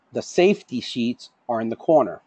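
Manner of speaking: "safety" punched above the other words; stress on SAFETY. Shown as a demonstration. Trainer demonstrating emphasis on the words, stress on "safety". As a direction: put the weight on "safety". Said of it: "changing the emphasis on the words".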